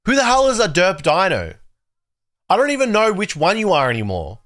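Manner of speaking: high-pitched, baffled exasperation; exasperated bafflement. Real, not acted. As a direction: pitch jumps up in confused exasperation. Baffled by chat's changed usernames.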